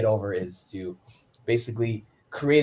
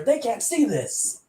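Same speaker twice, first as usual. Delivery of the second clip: mock alarm; 14 semitones higher, slower than usual. Acted panic over poster.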